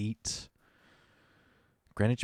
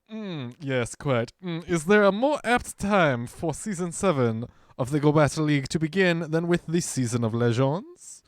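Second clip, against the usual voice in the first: higher, hammed-up French butler; 4 semitones higher than usual. Reading the announcement to chat, French butler voice. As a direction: put on a snooty French accent. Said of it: "I can't, like French butler".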